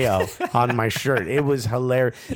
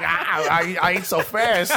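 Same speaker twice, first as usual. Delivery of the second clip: mock fat guy; 4 semitones higher, slower than usual. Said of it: "I'm just a fat guy walking around".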